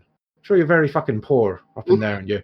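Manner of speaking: Irish dwarf, mock sympathy, high at first; sarcastic dwarf shopkeeper. Dwarf taunts noble hero about her castle, sarcastic. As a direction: needle the rich customer.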